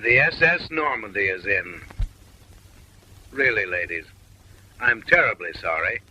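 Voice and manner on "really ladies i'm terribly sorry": ordinary talk, nothing marked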